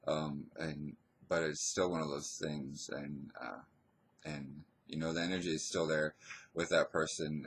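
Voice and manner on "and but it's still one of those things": ordinary talk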